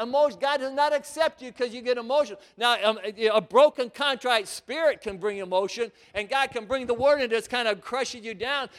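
ordinary delivery

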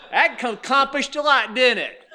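loud, high laughing mockery; mocking laugh. Preacher laughing at quarreling drivers, loud mocking. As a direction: tease them through laughter.

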